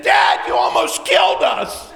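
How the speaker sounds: high, loud outburst; shouted accusation. Retold or imitated. Son to dad after a near crash, shouted accusation. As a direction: yell the blame at your dad.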